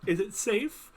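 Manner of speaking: high, timid little voice; timid hider. Acted. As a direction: peek out and ask nervously. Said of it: "he picks his head up".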